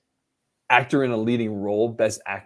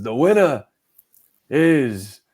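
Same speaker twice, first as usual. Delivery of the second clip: Al Pacino; 5 dB louder, much slower than usual. Acted. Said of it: "And he goes".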